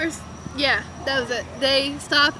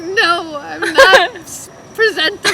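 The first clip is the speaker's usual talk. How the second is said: teary protest; 4 semitones higher, 8 dB louder, slower than usual. Retold or imitated. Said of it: "I'm like".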